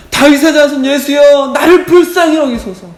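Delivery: high, full-force shout for mercy. Acted. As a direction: yell it desperately over the crowd. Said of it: "더 크게". (더 크게 절박하게 외치듯이)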